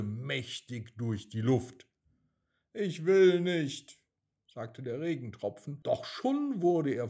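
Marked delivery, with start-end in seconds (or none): 2.7-4.0 higher, louder, slow little raindrop refusing; whiny raindrop. A narrator's reading. Raindrop protests to the wind, high and whiny. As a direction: drag out the refusal like a stubborn small child.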